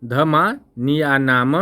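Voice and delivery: धमानियानाम stretched out, each syllable separate; slow syllable demo. Shown as a demonstration. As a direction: spell out धमानियानाम slowly. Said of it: "कह रहा है उपुप". (धमानियानाम को धीरे-धीरे तोड़कर)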